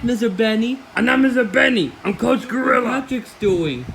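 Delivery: ordinary talk, nothing marked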